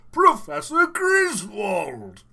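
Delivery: Professor Griswold. Shown as a demonstration. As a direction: call out his name in the professor voice. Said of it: "I was Prof".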